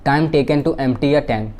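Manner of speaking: slow, word by word for notes; measured dictation pace. Shown as a demonstration. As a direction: dictate it so students can write.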